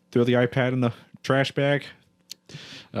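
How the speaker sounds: ordinary delivery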